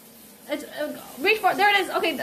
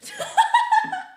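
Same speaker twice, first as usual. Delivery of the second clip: high laugh. Real, not acted. Sees the dyed hair.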